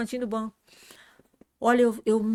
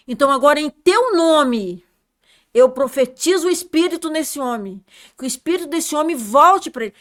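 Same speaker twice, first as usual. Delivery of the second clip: loud, high commanding proclamation; loud prophecy; 4 semitones higher, 8 dB louder than usual. Retold or imitated. Prophesying the spirit back into the man, loud proclamation. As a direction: declare it with full faith.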